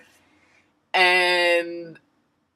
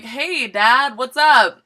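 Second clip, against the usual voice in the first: innocent act; 6 semitones higher, 7 dB louder, slower than usual. Retold or imitated. Answering dad's call while caught.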